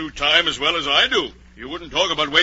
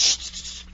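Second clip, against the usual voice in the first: hushing. Acted. Hatter hushes the table.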